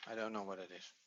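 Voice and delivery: soft, low admission of puzzlement; muttering to oneself. Real, not acted. Teacher stuck on a word in the exercise, muttering. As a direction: say it low, only to yourself. Puzzling over own possible mistake.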